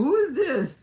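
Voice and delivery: high, loud startled question; startled who is this. Retold or imitated. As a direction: jump in surprise at the caller. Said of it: "I'm going".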